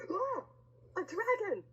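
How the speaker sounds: high, loud mock alarm; storybook shriek of fright; frightened cry. A narrator's reading. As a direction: gasp it out high as if a dragon appeared. Dragon appears in story.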